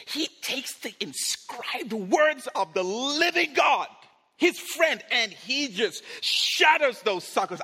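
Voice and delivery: loud, high, slow build; dramatic shouted telling. Real, not acted. Preacher telling congregation how Moses shatters the tablets, shouting. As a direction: proclaim it at full force.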